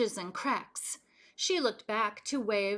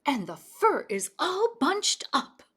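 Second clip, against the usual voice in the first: huffy Mama Bear; 5 semitones higher, 5 dB louder than usual. A narrator's reading. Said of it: "she huffed".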